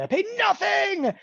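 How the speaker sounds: high, slow triumphant shout; excited. Real, not acted. Trader to viewers about free exits, a triumphant shout. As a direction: crow it out loud.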